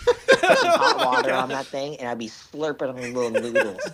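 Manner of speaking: words breaking into laughter; can't keep a straight face; laughing while talking. Real, not acted. Sailor tells his ramen-in-coffee-cup story to friends, laughing through it.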